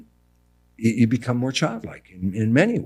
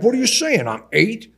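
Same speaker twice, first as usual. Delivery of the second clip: offended mother; 12 semitones higher, 5 dB louder than usual. Retold or imitated. Mother offended, voiced by son.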